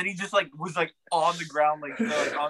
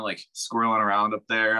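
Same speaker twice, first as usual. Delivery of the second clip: ordinary delivery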